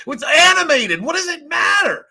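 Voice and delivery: high, loud mocking outburst; mock outrage. Mocking the angry actor, high shrill outburst. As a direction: shriek it in mock indignation.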